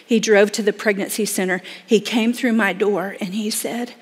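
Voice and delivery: ordinary delivery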